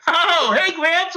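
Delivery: high-pitched ribbing hello; teasing young fans. Retold or imitated. Rap fans hail him as old.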